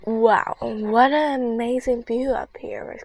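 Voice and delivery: loud, awed exclamation; excited exclaim. Acted. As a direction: wow at the view. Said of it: "Rebecca exclaimed".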